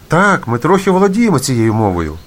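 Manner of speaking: high-pitched, eager answer; delighted reply. A narrator's reading. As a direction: answer gladly, voice lifted high. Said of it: "зраділи вони". (радісно, високим голосом)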